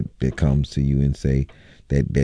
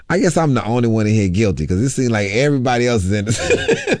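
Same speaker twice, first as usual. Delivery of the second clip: retold sarcastic dig, pitched higher; jovial sarcasm; 7 semitones higher, 3 dB louder than usual. Prisoner needling fellow inmates who claim innocence, jovially sarcastic. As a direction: play mock-guilty with jovial, higher-voiced sarcasm. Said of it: "I'll be so sarcastic".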